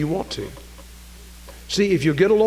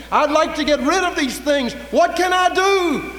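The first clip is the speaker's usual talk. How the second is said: full-force preaching; 10 semitones higher, 6 dB louder than usual. Real, not acted. Preacher pleading with the unsaved to be cleaned up, full-force preaching. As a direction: preach it hard, rising to a high, held plea.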